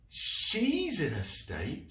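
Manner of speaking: high, slow disbelieving echo; incredulous. Acted. Fergus incredulous.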